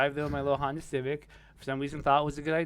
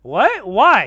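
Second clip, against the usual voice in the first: much louder, higher shocked questions; loud disbelief; 7 semitones higher, 16 dB louder than usual. Retold or imitated. Answering Rodney's plan, loud shocked disbelief. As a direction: blurt out in disbelief. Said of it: "I was like".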